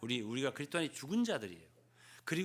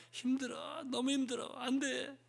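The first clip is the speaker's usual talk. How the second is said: higher whimpering moan; a higher, mock-weary groan; whiny complaint; 9 semitones higher, slower than usual. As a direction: whine like a worn-out believer. Believers complaining to each other.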